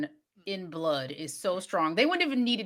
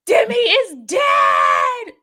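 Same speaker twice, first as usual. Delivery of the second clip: high, loud, drawn-out shout; hammed-up panicked yell; acted scream; 10 semitones higher, 9 dB louder, much slower than usual. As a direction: scream the news out, stretching every word. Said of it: "he's screaming".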